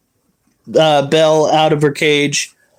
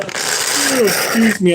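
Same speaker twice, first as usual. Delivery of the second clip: slow yawning words; 6 semitones higher, much slower than usual. Real, not acted. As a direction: talk through a yawn. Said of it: "I'm, like, yawning at the camera".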